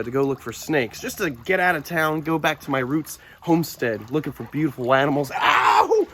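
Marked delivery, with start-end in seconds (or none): 5.3-6.1 sharp yelp of pain, much higher and louder; sudden pained shout. Real, not acted. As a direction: cry out as the teeth hit. Dog bites him.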